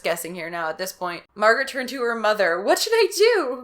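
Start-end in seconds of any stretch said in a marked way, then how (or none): 2.6-3.6 put-on high, loud lost girl; pleading. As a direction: ask her mother desperately.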